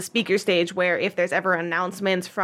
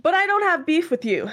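projecting voice; 8 semitones higher than usual. Acted. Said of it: "projecting his voice to, like, everybody".

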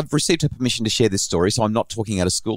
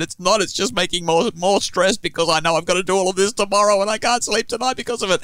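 daughter's overwhelmed plea, raised higher; stressed daughter; 10 semitones higher than usual. Retold or imitated. Teen daughter telling dad the plan only adds stress, pleading higher. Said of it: "And she said".

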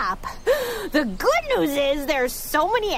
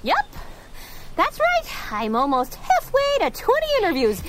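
ordinary delivery

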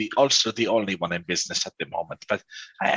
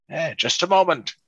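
put-on old man voice; 6 semitones higher than usual. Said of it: "this cautious old man".

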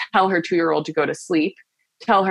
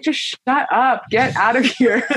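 higher, half-laughing mock snap; exasperated, laughing brush-off; annoyed at feelings; 7 semitones higher than usual. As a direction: playfully tell your feelings to get lost. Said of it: "I'm so annoyed with them".

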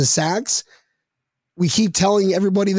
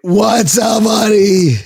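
loud greeting; 3 semitones higher, 7 dB louder, much slower than usual. Real, not acted. Loudly greeting caller on air.

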